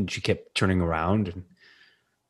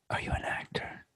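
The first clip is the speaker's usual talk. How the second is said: breathy whisper, very soft; whispered question; 16 dB quieter than usual. Retold or imitated. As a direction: lean in and whisper it to a stranger in a dark theater. Fan quietly asks in theater.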